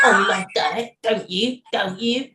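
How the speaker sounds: high, teasing British tag questions; playful mock British voice; British accent. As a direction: ham up the British boyfriend's accent. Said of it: "with the accent".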